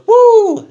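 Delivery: high, loud "woo"; imitated wrestler's cheer; imitated whoop. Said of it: "he goes".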